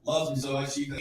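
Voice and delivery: normal talking voice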